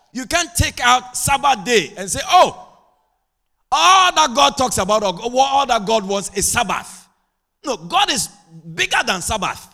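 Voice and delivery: high, loud, driving sermon voice; impassioned preaching. Real, not acted. Preacher telling church members to adapt, full-force preaching.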